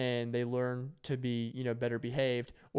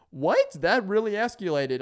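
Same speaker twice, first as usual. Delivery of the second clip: mock disbelief; 6 semitones higher, 5 dB louder than usual. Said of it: "You're like".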